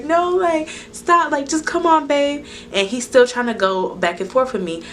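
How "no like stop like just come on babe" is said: high-pitched mock wife voice; high, urgent retold plea; imitated pleading wife. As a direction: plead like a wife pulling her husband away. Voicing wife pulling husband away.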